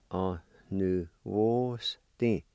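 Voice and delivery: "On new wars thing" said slowly, each word apart; slow teaching demo; slow pronunciation. Shown as a demonstration. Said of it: "It's pronounced like this".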